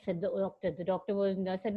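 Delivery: ordinary talk, nothing marked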